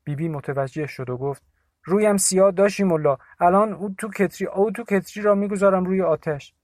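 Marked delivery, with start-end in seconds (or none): 1.8-6.5 put-on raised, higher old woman's blessing; Bibi's voice. A narrator's reading. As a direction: call out a worried blessing to the visitor. (صدای پیرزن، بلند و نگران)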